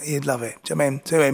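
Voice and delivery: usual speaking voice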